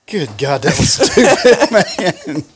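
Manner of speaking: cracking up mid-sentence; laughing. Retold or imitated. Laughing at own blunder.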